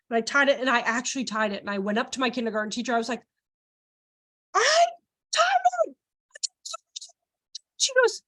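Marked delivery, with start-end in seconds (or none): 4.5-6.0 high, loud mock announcement; proud child. Kindergartner showing teacher her tied bow, mock proud squeal. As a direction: burst out in a high kid voice.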